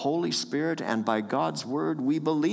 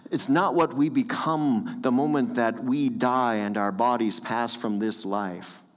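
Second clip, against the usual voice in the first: normal talking voice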